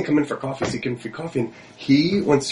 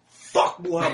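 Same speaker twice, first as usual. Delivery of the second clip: higher-pitched, lightly voiced impression of a mechanic; Jacob; 10 semitones higher, slower than usual. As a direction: imitate a riled-up mechanic, half laughing. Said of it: "He's like".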